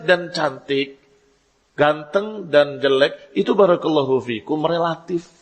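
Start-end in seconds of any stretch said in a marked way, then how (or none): none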